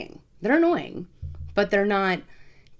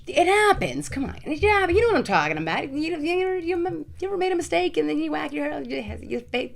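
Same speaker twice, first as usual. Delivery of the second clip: squeaky, much higher pleading; high-pitched protest; 10 semitones higher than usual. Real, not acted. Woman defending whacking herself to viewers, high-pitched protest.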